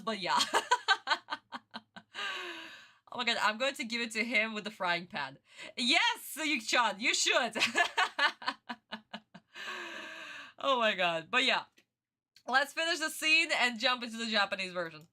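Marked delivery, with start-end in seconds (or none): 0.4-2.7 high, lingering laugh. Real, not acted. Streamer amused by her own accent, laughing. 7.6-10.5 long giggle trailing into breath; laugh. Real, not acted. Streamer tickled by frying pan joke, giggling.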